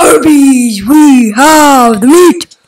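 loud, slow hammed-up ad shout; mock commercial slogan. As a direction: belt it like a fast-food ad. Kid hams Arby's slogan.

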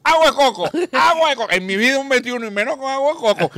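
high, loud indignant cry; outraged shout. Acted. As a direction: protest at the very idea. Drunk outraged at coconut mixer.

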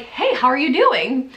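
a slow, high hello that gives away big news; something clearly up. Retold or imitated. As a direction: act casual while hiding news. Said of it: "could instantly tell from my voice, like, something was up".

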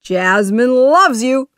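loud, high, gleeful genie; happy exclamation. A narrator's reading. Genie tells Aladdin he is loved, happily. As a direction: share the good news happily. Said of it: "the genie said happily".

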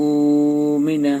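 উমিনা with the first উ drawn out very slowly; madd lengthening. Shown as a demonstration. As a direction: hold the উ of উমিনা one alif long. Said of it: "এই যে প্রথমটা একালিপ টান দিতে হবে". (উমিনা প্রথম উ টেনে ধীরে)

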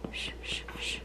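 soft whispered shushing. Real, not acted. Baby cries, parent hushes her.

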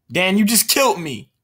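indignant shout at Dan for killing him; outraged yell. Real, not acted. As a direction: yell it at the friend who betrayed you. Killed by friend Dan.

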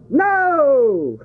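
sudden high burst; emphatic no. Real, not acted. Preacher answering his question to the congregation, emphatic. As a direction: bark a big high no.

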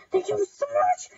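very high, loud squealed thanks; giddy, sky-high and gushing; overjoyed squeal. Real, not acted. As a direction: squeal your gratitude.

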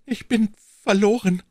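high, shaken queen; a stammered cry of despair; the queen alone, despairing. A narrator's reading. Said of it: "stammelte die Königin".

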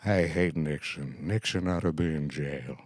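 put-on a light old Groucho, slow and dry; slow Groucho answer. Groucho answering young Frank about Nixon, slow dry delivery. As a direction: growl it slowly like a tired old comic. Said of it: "And he says".

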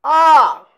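a preacher's mock demon scream; demon crying out. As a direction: cry out like the possessed man. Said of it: "en criant".

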